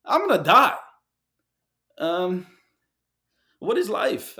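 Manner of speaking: put-on a young man told he needs heart surgery, panicking aloud; panicked inner voice. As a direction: blurt it out like the floor just dropped. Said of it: "I'm like".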